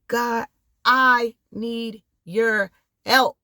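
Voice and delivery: drawn out very slowly, rising at the end; slow drawn-out plea. Retold or imitated. Vlogger voicing a prayer for help, very slow and rising. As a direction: stretch the cry for help out slowly. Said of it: "the moment you say".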